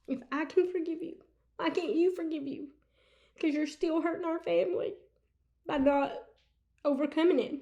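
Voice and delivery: high, soft, tearful quote; halting plea, a phrase at a time; choked up. Retold or imitated. Retells husband's forgiveness, moved to tears.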